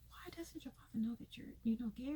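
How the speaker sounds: hushed, secretive whisper; soft conspiratorial whisper. Real, not acted. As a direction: lean in and whisper it like gossip.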